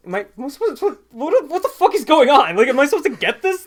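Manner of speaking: confused mock panic. Co-host imagining McAvoy baffled on set, panicky and loud. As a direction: blurt it out lost and confused. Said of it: "he's looking at the director, like".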